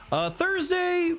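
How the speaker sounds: high, slow squeaky question; surprised high-pitched Thursday. Real, not acted. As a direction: squeak it out in disbelief.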